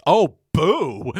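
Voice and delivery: sudden high loud boo; mock boo. Troy booing Jeff at the table, sudden loud boo. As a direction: blurt out a mocking boo. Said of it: "Troy just breaks out and goes".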